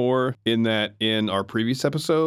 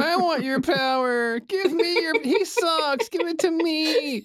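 high, slow whining; whiny Master voice; slower than usual. Retold or imitated. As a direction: whine like a spoiled villain. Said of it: "you sound like a little whiny bitch".

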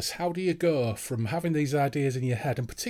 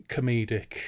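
normal talking voice

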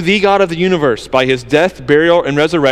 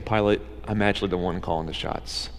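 soft, low, certain statement; quiet; 11 semitones lower, 14 dB quieter than usual. Retold or imitated. Jesus quietly telling Pilate who is in charge. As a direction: say it quietly, fully in control. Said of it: "Quietly saying".

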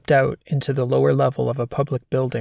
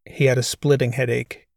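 ordinary delivery, nothing marked

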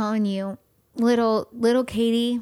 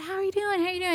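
higher, sing-song concern; mock concern; 10 semitones higher, 3 dB quieter, much faster than usual. She mimics well-wishers asking after her grief, sing-song higher. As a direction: mimic people fussing over you. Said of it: "everybody always comes up to you".

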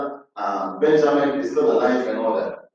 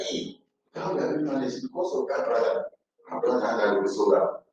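guilty murmur; 4 semitones lower, 6 dB quieter than usual. Acted. Brothers murmur among themselves.

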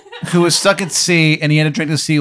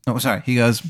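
ordinary delivery

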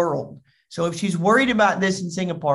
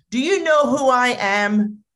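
higher, self-important demand; acted pompous outrage; indignant customer; 6 semitones higher, 3 dB louder than usual. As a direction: imitate an entitled big shot. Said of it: "And then he goes".

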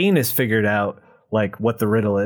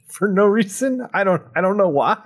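higher, laughing through the words; laughing disbelief; 8 semitones higher than usual. Real, not acted. Recapping the goons' shooting to a cohost, laughing. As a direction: crack up at how pointless it is. Laughing at absurd plot twist.

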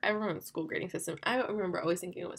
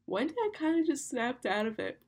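higher, stretched words through a real yawn; 5 semitones higher than usual. Real, not acted. Host telling her listeners the story while yawning. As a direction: say it mid-yawn. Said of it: "Sorry, I'm literally yawning".

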